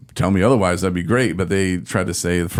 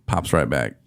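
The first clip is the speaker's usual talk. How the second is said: ordinary talk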